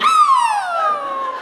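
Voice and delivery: loud, high-pitched yelp; a hammed-up high cry; high mock scream. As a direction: shriek it out in a sudden burst. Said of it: "she went".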